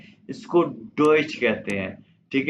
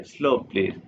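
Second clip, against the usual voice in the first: usual speaking voice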